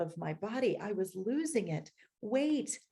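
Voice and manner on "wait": higher, urgent inner cry; a panicked plea held inside; silent inner scream. Retold or imitated. Panicking in therapy, a mild inner cry. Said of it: "I silently screamed".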